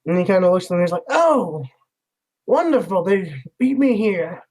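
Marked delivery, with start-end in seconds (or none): 1.1-4.4 falsely pleased, dragging out the end; passive-aggressive. Acted. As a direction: act mildly put out under fake delight. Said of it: "kind of passive-aggressive type of tone".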